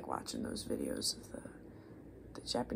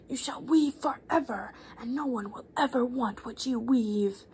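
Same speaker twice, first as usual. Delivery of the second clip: louder, slow whispered curse rising high; goddess screaming curse; 4 semitones higher, 7 dB louder than usual. Acted. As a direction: hurl the curse down at the girl. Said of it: "she screamed at the terrified Arachne".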